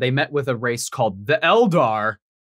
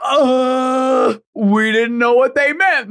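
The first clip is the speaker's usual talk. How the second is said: sheepish Horace; 6 semitones higher, 3 dB louder, much slower than usual. Retold or imitated. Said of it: "And Horace is like".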